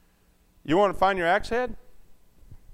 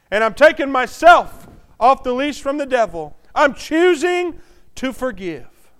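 loud, higher fervent preaching; preaching at full force; 7 semitones higher, 10 dB louder than usual. Acted. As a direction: pour it out to the congregation.

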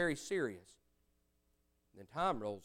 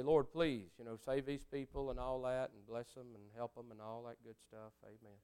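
half-hearted prayer; 7 semitones lower, 13 dB quieter than usual. Retold or imitated.

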